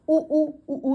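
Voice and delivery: उ, उ, उ sung out loud with lips pushed round; rounded-lip उ demo. Shown as a demonstration. Teacher showing students how lips round on उ, उ, उ, said loudly. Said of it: "उ से हमारे होंठ गोल गोल की शेप में आ रहे हैं".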